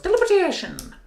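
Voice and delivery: put-on high, loud Irish accent shout; Irish accent catchphrase. Streamer shouts his Irish accent catchphrase for viewers. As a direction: belt it out in a hammy Irish accent. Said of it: "it goes into an Irish accent".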